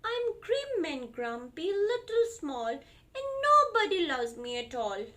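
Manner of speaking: put-on high, slow sulky child voice; storybook little one, grumpy. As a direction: whine it like a sulking toddler. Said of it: "Small said".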